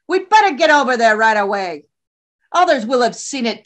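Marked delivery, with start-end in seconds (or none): none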